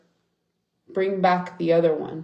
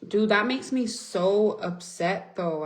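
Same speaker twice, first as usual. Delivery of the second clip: usual speaking voice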